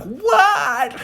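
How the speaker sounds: high, loud shocked squawk; hammed-up loud astonishment; incredulous outburst. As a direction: blurt it in sky-high disbelief. Retold shocked reaction to friend.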